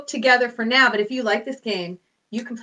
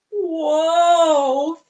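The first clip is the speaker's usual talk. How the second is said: amazed whoa; 5 semitones higher, 7 dB louder than usual. Pretend. Seeing big donkey.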